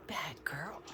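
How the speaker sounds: soft whispered mock scolding. Real, not acted. Owner teasing the bird, whispered scold.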